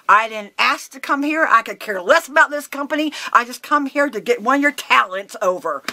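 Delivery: heated retelling of a walkout; mad retort. Retold or imitated. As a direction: throw it back at them defiantly. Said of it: "really mad".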